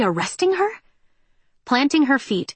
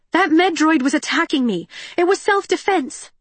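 desperate; 8 semitones higher than usual. A narrator's reading. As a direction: protest in panic. Pleading with armed android.

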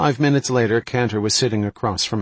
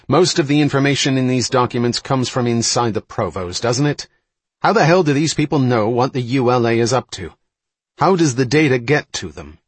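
louder, higher, insistent challenge; snapping; 4 semitones higher, 6 dB louder than usual. A narrator's reading. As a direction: press the colleague hard, irritated and forceful. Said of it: "Ryan snapped".

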